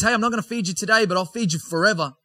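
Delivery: high, fast breezy offhand tone; casual paraphrased Jesus. Retold or imitated. Preacher paraphrases Jesus to the crowd, breezy high quick voice. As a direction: toss it off lightly, quick and high.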